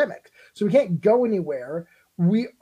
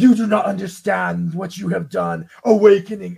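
imitating a villain monologue; lightly voiced grand villain speech; slow theatrical villain menace; 6 dB louder than usual. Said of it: "he will full on villain monologue".

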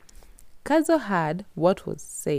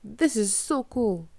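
excited shout; 5 semitones higher, slower than usual. Acted. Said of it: "Kadzo shouted".

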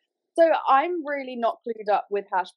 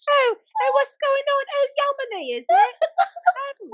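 high, squeaky put-on British accent, laughing through it; mock British accent. Shown as a demonstration. Doing her British accent on request for friends, high and giggly. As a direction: ham up a British pub-goer accent, much higher. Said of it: "I'll do mine".

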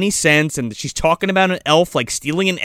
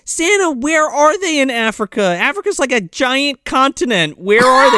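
high, questioning fairy voice; incredulous fairy; 7 semitones higher than usual. Retold or imitated. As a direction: press Santa for details. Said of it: "she's like".

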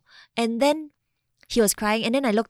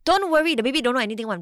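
quick, louder, higher brush-off; imitated careless parent, pitched up and fast; mock dismissive reassurance; 5 semitones higher, 5 dB louder, faster than usual. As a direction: wave the worry away, mimicking others. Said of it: "then people have arguments".

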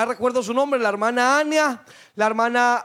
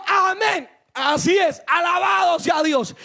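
exultant reply; 5 semitones higher, slower than usual. Retold or imitated. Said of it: "pues yo les decía".

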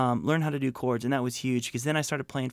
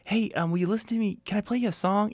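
higher, hopeful fan asking; eager teen fan; 6 semitones higher than usual. Retold or imitated. Young fan to Michael, hopeful and higher. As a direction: ask like a starstruck kid.

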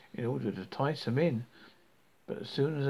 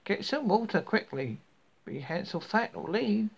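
angry; 6 semitones higher than usual. A narrator's reading. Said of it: "she called in a passion".

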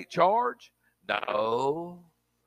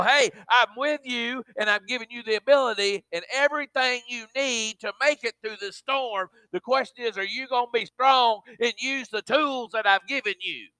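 preaching at full force; 5 semitones higher than usual. Acted. Preacher urging the congregation to trust God, full force. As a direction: build it up and push it to the back pew.

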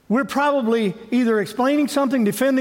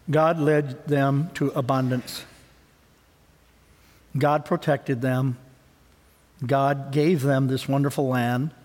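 reverent listing; 7 semitones lower, slower than usual. Acted. Slow soft list of blessings.

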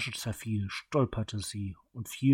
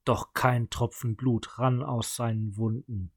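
usual speaking voice

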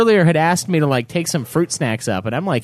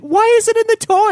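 put-on high, exasperated shout at the kid; outraged high yell; 4 dB louder, faster than usual. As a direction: demand an answer, voice cracking up high. Grabbing the kid, yelling.

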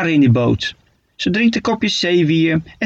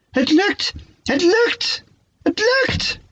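rising higher with each cry; excited squeal; slower than usual. A narrator's reading. Shark squealing with joy on first swim. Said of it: "gilt Frederik naar Manfred".

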